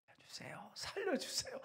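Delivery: breathless whispered plea, held back out of pride; timid whisper. Shown as a demonstration. Preacher mocking a prayer too proud to cry out, whispered. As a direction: whisper it so nobody hears you. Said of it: "이렇게 할 수 있어요?".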